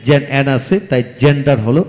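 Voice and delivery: ordinary delivery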